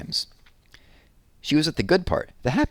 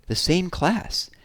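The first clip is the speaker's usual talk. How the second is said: delighted; 9 semitones higher, much slower than usual. A narrator's reading. Jessie's joy at news.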